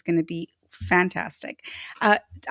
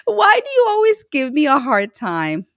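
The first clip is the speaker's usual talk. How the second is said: mock complaint; 6 semitones higher, 7 dB louder than usual. Mock complaint to a teasing viewer.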